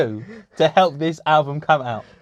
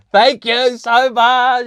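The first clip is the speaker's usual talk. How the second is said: much higher, louder, very slow gushing thanks; imitating Tony gushing; 10 semitones higher, 7 dB louder, much slower than usual. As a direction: stretch out an overjoyed thank you. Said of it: "Tony would be like".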